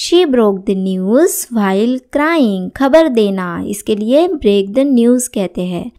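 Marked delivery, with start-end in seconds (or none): 0.1-2.7 very slow, every word laid out clearly; slow model sentence. Shown as a demonstration. Teacher reading the example sentence to students, very slow. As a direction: say it slowly so learners can follow. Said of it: "teacher models English sentence".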